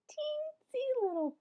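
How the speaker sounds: put-on squeaky high for a teensy push; playful squeak on teensy; tiny high voice.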